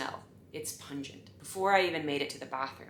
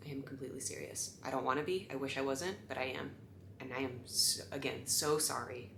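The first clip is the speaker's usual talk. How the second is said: hushed apology; 4 semitones lower, 10 dB quieter than usual. Retold or imitated.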